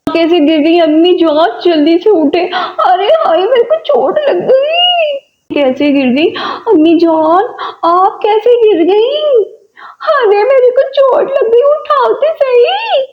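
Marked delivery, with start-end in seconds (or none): 2.4-5.1 put-on high, pained old woman's cry; hurt grandmother wailing. दादी गिरकर दर्द से कराहती. 10.1-13.0 put-on high, pained old woman's cry; hurt grandmother wailing. दादी गिरकर मदद मांगती.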